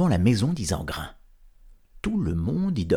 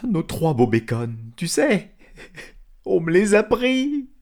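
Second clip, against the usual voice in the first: lamenting; 8 semitones higher, 3 dB louder than usual. Acted. Isengrin laments the stolen hams.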